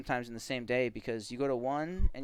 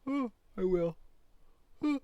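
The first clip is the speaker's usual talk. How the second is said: yawning; 9 semitones higher than usual. Real, not acted. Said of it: "Yeah, you're yawning".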